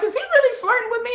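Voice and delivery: high-pitched disbelieving question; incredulous. Retold or imitated. Dreamer asks herself about his flirting, incredulous. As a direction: wonder it to yourself, voice lifted high. Stunned realization, retold.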